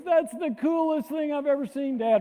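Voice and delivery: high, loud, thrilled son's voice; son amazed. Retold or imitated. Son David to his dad after the crash, awed and thrilled. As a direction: gush with awe at his dad's crash. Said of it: "And David goes".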